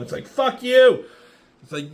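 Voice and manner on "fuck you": high, louder mock yell; retold defiant yell. Fighter answering a demand to yield, mock defiant yell. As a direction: fling back a high-pitched, acted refusal. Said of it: "Tora is yelling at Xander to yield".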